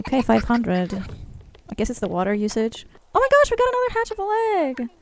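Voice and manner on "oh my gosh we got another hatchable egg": high, fast excited squeal; thrilled. Real, not acted. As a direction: rush it out, high with delight. Reacting to a new egg.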